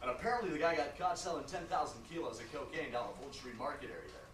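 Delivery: low-pitched, buddy-buddy attorney voice; chummy lawyer. Acted. Lawyer chatting to client about a cocaine bust, chummy. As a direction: play the genial lawyer telling a case story.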